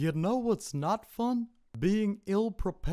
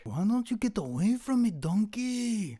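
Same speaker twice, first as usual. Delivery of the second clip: put-on Shrek with a Mexican accent; 4 semitones higher, 3 dB quieter than usual. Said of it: "Mexican Shrek".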